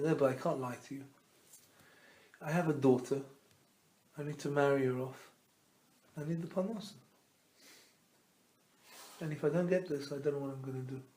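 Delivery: soft, subdued confession to the rebbe; retold heartbroken plea. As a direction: plead quietly as a broken-hearted father, understated. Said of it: "He started to cry".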